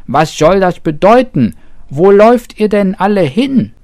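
high, loud, fast anxious calling; shouting. A narrator's reading. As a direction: call out after the fleeing animals. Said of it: "schrie das Häschen".

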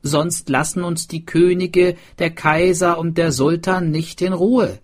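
high, loud character voice; swineherd. A narrator's reading. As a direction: argue the plan with conviction. Swineherd explains the plan.

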